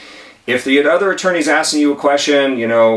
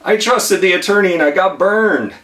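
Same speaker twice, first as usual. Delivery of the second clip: higher, rueful oh geez; mock dismay at being tricked; dismayed witness; 8 semitones higher than usual. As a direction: play the burned, regretful witness. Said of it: "you're going like".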